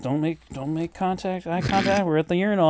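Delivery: hushed-down, no-nonsense aside; straightforward warning. Retold or imitated. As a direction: say it quietly, keep it matter-of-fact. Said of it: "very straightforward".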